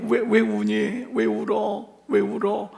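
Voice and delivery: gentle questioning. Acted. Preacher voices Jesus asking weeping Mary why, tenderly. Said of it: "나무라는 말이 아니에요".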